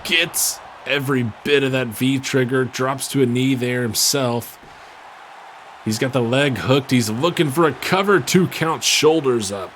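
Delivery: loud, high play-by-play call; excited. Real, not acted. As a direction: ramp up for the pin attempt. Big move and cover called.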